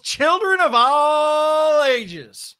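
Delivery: booming ringmaster voice, drawn out; grand entrance announcement. Acted. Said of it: "bringing the energy on the intro".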